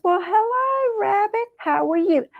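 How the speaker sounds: very high, gushing greeting; effusive Possum. Acted. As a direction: greet the visitor grandly, pitch way up. Possum greets his visitor effusively.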